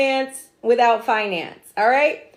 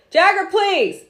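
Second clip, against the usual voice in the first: raised, higher call to someone off camera; scolding call; 8 semitones higher, 5 dB louder, slower than usual. Real, not acted. Streamer calling off camera to stop the noise, raised scold. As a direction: snap a quick reprimand offscreen.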